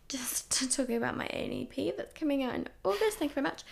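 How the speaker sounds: whispered aside with a laugh. Real, not acted. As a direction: sneak the plug in under a giggle. Cheeky aside plugging own EP.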